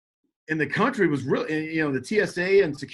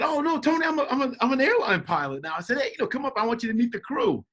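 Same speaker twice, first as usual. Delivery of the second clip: high, cheerful correction; eager explaining; 10 semitones higher than usual. Retold or imitated. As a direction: brush off the worry and invite him up. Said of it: "so excited to see my brother".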